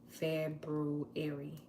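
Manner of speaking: February drawn out slowly, feb-ru-ary. Shown as a demonstration. Showing viewers the right way, slowly. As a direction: stretch every syllable of February. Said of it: "That's how you say it".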